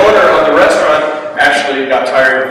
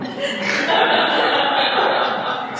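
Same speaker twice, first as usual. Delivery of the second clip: soft laugh; 3 dB quieter than usual. Real, not acted. Laughing after punchline.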